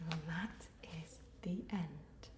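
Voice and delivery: soft unvoiced whisper to close the story. Teacher ending the story for children, whispered. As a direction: breathe the ending like a secret.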